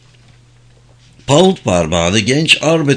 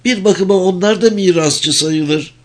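high-pitched, lightly eager suggestion; 10 semitones higher than usual. A narrator's reading. Mother hoping the taxmen will search, eager. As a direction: pitch it up with a touch of keenness. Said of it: "Bayan Armitage'in sesi heves doluydu".

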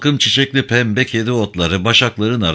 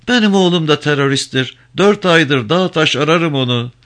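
put-on higher, sorrowful old woman's voice; mother admitting her son is in the mountains; grieving old mother; 7 semitones higher than usual. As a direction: confess it like a mother who has searched for months. Mother confesses about her son.

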